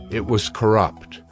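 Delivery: normal talking voice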